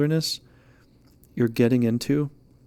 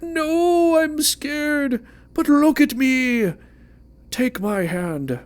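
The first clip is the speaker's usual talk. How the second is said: very high, loud, slow and trembling with mock fear; squeaky scared voice; 13 semitones higher, 6 dB louder, much slower than usual. The querent talking back to the hand, high squeaky mock fright. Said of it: "And you're like".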